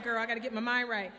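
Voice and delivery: ordinary delivery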